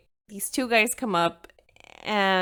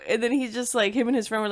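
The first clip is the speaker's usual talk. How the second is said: ordinary talk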